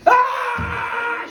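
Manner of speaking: a sudden high, loud "Ah!" yell; furious shout. Acted. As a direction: roar out in outrage. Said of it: "shouts Rafa".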